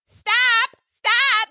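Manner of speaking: loud high shouted stop; yelling stop. Retold or imitated. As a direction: shout it at the other driver. Yelling at driver while honking.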